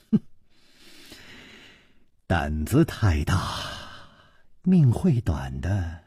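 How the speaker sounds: low, soft veiled threat; cold sneer. A narrator's reading. In-law eyeing the bold hero, low cold sneering. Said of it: "冷笑着说".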